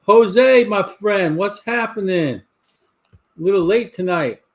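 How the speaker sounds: loud, high call-out to a friend; hearty greeting. Real, not acted. Host greeting a viewer who joined late, loud and high. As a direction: hail him loudly across the room.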